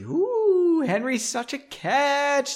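high, slow, gushing ooh over a dreamy guy; mock swoon. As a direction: swoon over him in jest, hammed-up. Swooning over Henry in jest.

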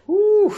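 high, loud whew; exhaled whoop at the work left. Real, not acted. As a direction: blow out a high-pitched whew. Reader sighs at pages left.

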